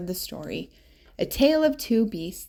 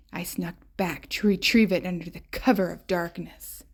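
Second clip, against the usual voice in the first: ordinary delivery, nothing marked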